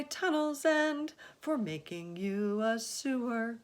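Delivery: high, lilting verse; rhyming recital. Acted. James introduces the centipede in verse, high and lilting. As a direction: present each friend proudly in rhyme.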